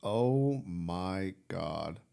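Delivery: slow, quiet, stunned disbelief; stunned whisper. Real, not acted. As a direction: let it sink in, very slowly. Stunned after the replay.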